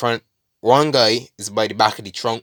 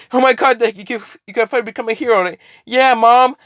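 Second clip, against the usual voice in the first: high, fast hammed-up gushing; mock teary excitement; 11 semitones higher, 4 dB louder, faster than usual. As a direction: overplay the fake joy. Mocking fake tearful reunion.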